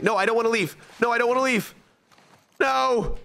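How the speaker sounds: high whiny plea; whiny pleading. Real, not acted. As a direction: beg it not to leave, high-pitched. Pleading with game not to leave.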